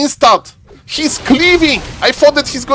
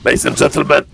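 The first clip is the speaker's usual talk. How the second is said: much lower put-on voice; deep put-on voice; 8 semitones lower, faster than usual. A deep put-on voice for the tier three sub. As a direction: sink into the special deep voice. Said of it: "I can't talk like this for long".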